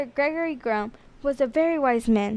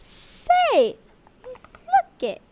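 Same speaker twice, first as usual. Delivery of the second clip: put-on a much higher little girl's exclaim; much slower than usual. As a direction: point it out like a small girl. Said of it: "said Maisie".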